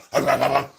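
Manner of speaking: short victory chuckle; laugh. Real, not acted. Fan laughing after the game ends, amused. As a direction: laugh off the tension.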